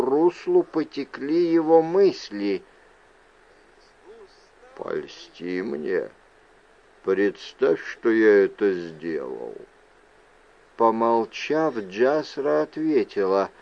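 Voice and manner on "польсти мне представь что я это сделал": low, soft, slow-starting voice; deep, hushed request; quietly insistent man. A narrator's reading. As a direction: press the point softly, deep down in pitch. Mender presses his question, same low voice.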